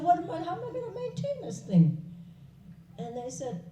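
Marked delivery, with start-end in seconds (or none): 0.0-2.0 fretful renunciate. Acted. Renunciate frets to villagers.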